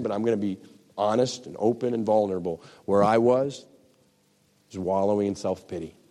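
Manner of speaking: normal talking voice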